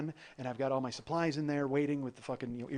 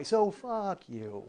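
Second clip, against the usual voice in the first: very slow, drawn-out taunt; higher, savoring the insult; gloating; 4 semitones higher, much slower than usual. Acted. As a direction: stretch each word out smugly. Gloating over priority access.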